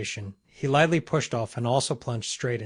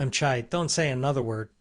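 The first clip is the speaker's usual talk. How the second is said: ordinary delivery, nothing marked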